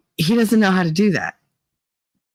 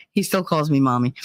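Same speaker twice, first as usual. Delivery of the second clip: ordinary talk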